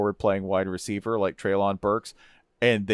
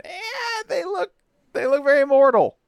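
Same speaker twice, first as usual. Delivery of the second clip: high, strained 'they look very mortal' with stress on very; mock worried fans; 4 dB louder than usual. Voicing rival fans doubting Bama, high and whiny. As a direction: whine it like nervous rivals. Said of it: "that we're all kinda going".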